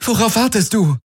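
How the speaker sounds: high-pitched impatient shout; urgent demand. Acted. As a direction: demand action now, pitch way up. Galen drängt Kirai zur Eile. (drängend und hoch gerufen)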